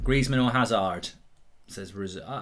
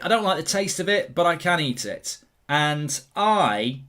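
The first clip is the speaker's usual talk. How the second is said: ordinary delivery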